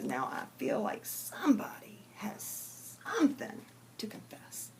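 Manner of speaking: preacher whispering to the congregation; hushed preacher. Acted. Preacher whispers to the church, urging a confession. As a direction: lean in and whisper the coaxing.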